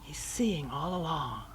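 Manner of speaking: hushed, breathy inner voice; whispered sermon aside; whispered reflection. Real, not acted. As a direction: murmur it like a private confession.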